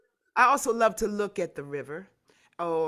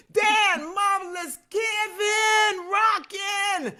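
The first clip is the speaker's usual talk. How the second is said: high, loud cheering of each name; excited shouting; 10 semitones higher, 5 dB louder, much slower than usual. Real, not acted. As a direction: cheer them on at full voice. Cheering names of participants.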